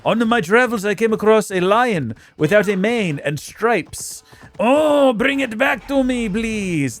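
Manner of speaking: put-on ancient Greek accent, slowing as it goes; quest-giver in a shaky ancient Greek accent; loosely done ancient Greek accent; mock ancient Greek accent. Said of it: "That's definitely just not a Greek accent".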